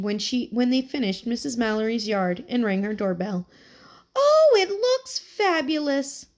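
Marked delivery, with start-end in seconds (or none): 4.2-6.3 high, slow, delighted crow; crowing old lady. A narrator's reading. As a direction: gush over the lawn like a proud granny. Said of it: "she crowed".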